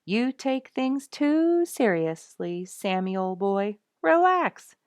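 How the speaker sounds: high, slow lazy porcupine drawl; lightly voiced porcupine; gracious grunts. A narrator's reading. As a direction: voice Inky as an easygoing porcupine. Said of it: "saying in his most gracious grunts".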